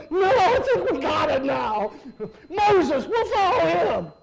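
loud, high eager shout; hasty surrender. Acted. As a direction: shout agreement to avoid the next punishment. Frightened Israel submits.

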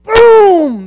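shouted high Boom!; the hare takes off, boom; loud boom. Retold or imitated. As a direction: blast it like a starting shot. Rabbit starts the race.

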